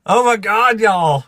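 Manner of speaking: loud, high cry of disbelief; astonished outburst. Real, not acted. Streamer floored by viewers' gifts, astonished shout.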